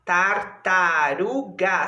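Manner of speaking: tartaruga slowly split, tar-ta-ru-ga; syllable by syllable. Shown as a demonstration. Teacher showing pupils how to complete a word, syllable by syllable. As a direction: stretch out each syllable of tartaruga. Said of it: "Você pode completar aí com a palavra".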